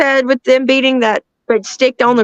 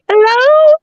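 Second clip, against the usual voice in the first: calling out; 13 semitones higher, 5 dB louder than usual. Retold or imitated. Calling out into the woods.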